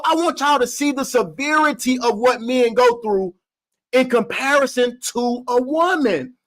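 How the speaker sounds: usual speaking voice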